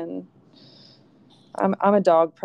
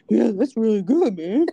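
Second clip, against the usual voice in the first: louder, higher put-on dog character; voicing a dog; 4 semitones higher, 6 dB louder than usual. Shown as a demonstration. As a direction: speak up as a chatty dog would. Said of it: "saying out loud what I think they're saying".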